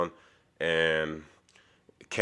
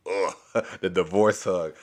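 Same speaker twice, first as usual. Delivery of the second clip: laughing. Real, not acted. Amused echo of the joke.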